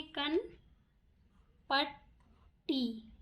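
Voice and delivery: पट्टी drawn out slowly; slow, careful पट्टी; slow dictation. Shown as a demonstration. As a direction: stretch पट्टी as you write. Teacher dictates word while writing.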